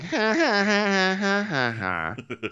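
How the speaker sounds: high, spelled-out forced ha ha; hammed-up polite laugh; mock fake laugh. Host imitating Meredith laughing, high and forced.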